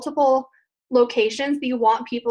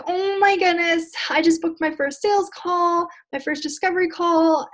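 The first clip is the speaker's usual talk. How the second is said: high-pitched, gushing excitement; excited, higher-pitched acted quote; super excited; 6 semitones higher than usual. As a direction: act out a newcomer's giddy excitement. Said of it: "you're super excited".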